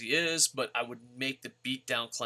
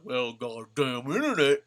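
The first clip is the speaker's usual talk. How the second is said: mock Magnus whine; 6 dB louder than usual. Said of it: "you go online and be like".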